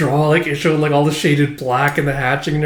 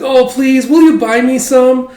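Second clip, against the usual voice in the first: put-on high, loud pleading little boy; whiny child voice; 11 semitones higher, 7 dB louder, slower than usual. Son begging his father to take him to the festival, high whiny child. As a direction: beg like a small kid tugging at dad.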